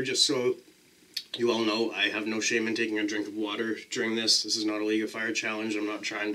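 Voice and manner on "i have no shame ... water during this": normal talking voice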